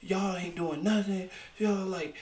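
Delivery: irritated customer, hammed up; mock angry guest. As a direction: imitate a pushy guest demanding help. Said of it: "he was like".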